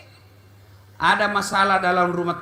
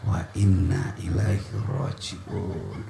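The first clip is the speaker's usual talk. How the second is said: much lower, slow devout recital; solemn recitation; 6 dB quieter, slower than usual. Shown as a demonstration. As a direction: recite it low and slow like a prayer. (bacaan khusyuk, rendah dan pelan)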